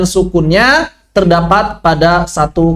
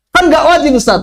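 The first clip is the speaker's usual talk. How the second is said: student protesting; 9 semitones higher than usual. Retold or imitated.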